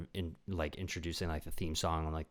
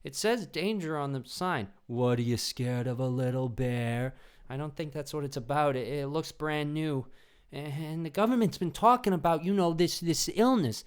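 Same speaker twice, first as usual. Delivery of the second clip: higher, timid worried voice; nervous movie friend; 8 semitones higher than usual. Retold or imitated. Wary friend warning about the danger sign, timid and higher. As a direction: hesitate like the scared sidekick. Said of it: "they're like".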